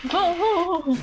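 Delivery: sudden high, loud startled cry; jolted by a jump scare; startled whoa. Real, not acted.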